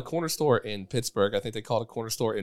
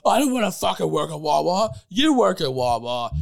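high protest, dragging into a retort; hammed-up squabbling; mock indignant; 6 semitones higher, 4 dB louder than usual. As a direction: throw the job back at your partner. Acted quarrel over a job.

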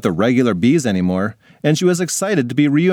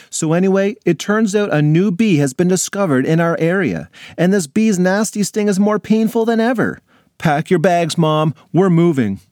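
put-on higher, dramatic young girl; a kid breathlessly pleading with mom; excited girl; 7 semitones higher than usual. Alex excitedly tells her mom.

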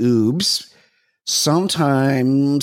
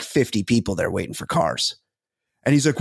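ordinary talk

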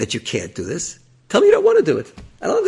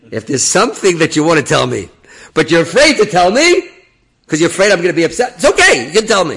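much higher and louder, pleading; coaxing rabbi; 7 semitones higher, 12 dB louder than usual. Retold or imitated. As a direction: coax him to open up, in a high voice.

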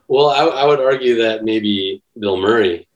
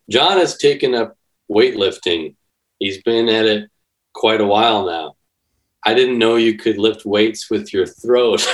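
usual speaking voice

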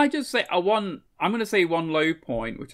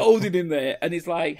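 ordinary delivery

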